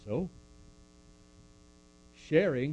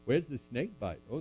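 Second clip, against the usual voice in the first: ordinary talk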